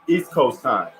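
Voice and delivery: normal talking voice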